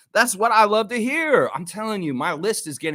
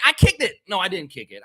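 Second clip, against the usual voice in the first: normal talking voice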